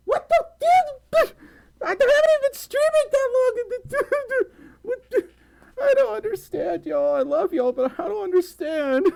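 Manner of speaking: extremely high, tearful-sounding squeal of disbelief; overwhelmed squealing. Real, not acted. Streamer overwhelmed by viewers' generosity, high disbelieving wail. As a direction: squeak it out, overcome and half laughing.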